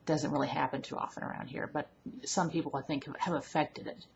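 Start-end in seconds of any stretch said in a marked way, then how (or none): none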